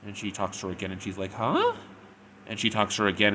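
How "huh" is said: high questioning huh, swooping up; sharply rising, puzzled squeak; hard-of-hearing daughter. Retold or imitated. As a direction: imitate a kid who can't hear. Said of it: "daughter is hard of hearing".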